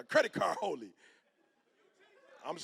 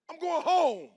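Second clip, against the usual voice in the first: insistent refusal; 10 semitones higher, 7 dB louder, slower than usual. Retold or imitated. Repeated refusal.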